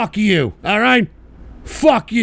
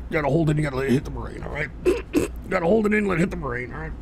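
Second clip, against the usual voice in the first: softer, strained talk with lungs full; holding in smoke; 5 semitones lower, 8 dB quieter, much faster than usual. Real, not acted. Streamer smoking, talking while holding smoke in. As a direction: speak while holding the hit.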